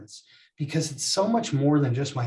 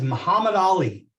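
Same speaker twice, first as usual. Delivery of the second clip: loud and direct; 4 semitones higher, 6 dB louder than usual. Retold or imitated. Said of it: "He says it very loud and direct".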